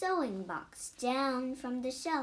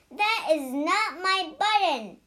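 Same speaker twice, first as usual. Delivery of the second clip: louder storybook shout at Frog; 6 semitones higher, 8 dB louder than usual. A narrator's reading. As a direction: shout it out in frustration. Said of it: "shouted Toad".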